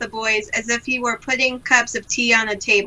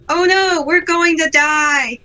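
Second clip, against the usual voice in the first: put-on panicked boy; 5 semitones higher, 4 dB louder, slower than usual.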